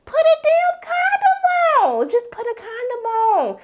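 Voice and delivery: very high, drawn-out squealed plea; exasperated wail. Acted. Vlogger pleading with the careless boyfriend, exasperated high wail.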